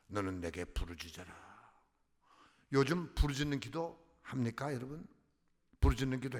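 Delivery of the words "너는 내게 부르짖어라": quiet, low repeat of 너는 내게 부르짖어라; soft echo. Shown as a demonstration. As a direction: say 너는 내게 부르짖어라 again, hushed and low.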